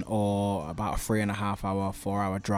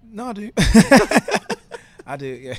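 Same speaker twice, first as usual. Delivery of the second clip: laughing admission; 11 semitones higher, 14 dB louder than usual. Real, not acted. Laughs at accent teasing.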